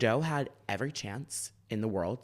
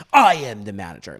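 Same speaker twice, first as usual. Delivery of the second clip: smug reveal; 15 dB louder than usual. Retold or imitated. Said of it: "He goes".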